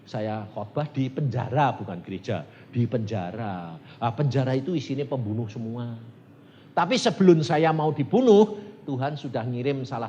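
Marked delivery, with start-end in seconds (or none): none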